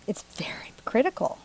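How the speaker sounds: hushed, solemn joke; mock grave whisper. Painter joking feet matter, mock grave whisper. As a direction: whisper it with fake gravity.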